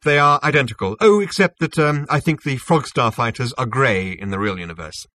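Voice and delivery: lightly voiced self-assured official; smug Zarniwoop, explaining. Zarniwoop explains his synthetic universe to Zaphod, lightly smug. Character dialogue in narration.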